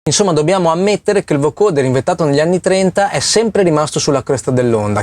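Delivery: ordinary delivery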